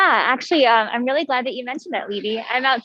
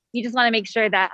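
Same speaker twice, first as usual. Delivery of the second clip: ordinary talk, nothing marked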